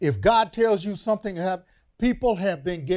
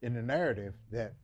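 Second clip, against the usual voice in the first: low, soft preacher's storytelling; quiet sermon teaching; 7 semitones lower, 8 dB quieter than usual. Acted. Preacher softly setting up the Passover story. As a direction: draw them in quietly before the point.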